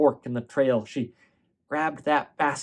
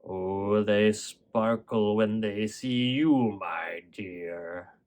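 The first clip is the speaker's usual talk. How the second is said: lower, softer, sly wolf voice, slow; wolf posing as grandma; 4 semitones lower, 4 dB quieter, much slower than usual. Acted. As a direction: coax her closer in a low, slow hush.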